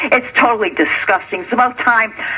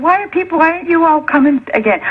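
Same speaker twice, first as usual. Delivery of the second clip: higher-pitched retold polite question; imitating a polite appeal in a raised pitch; retold nice asking; 7 semitones higher than usual. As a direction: ask it high, as if being very nice. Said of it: "I asked them very nicely".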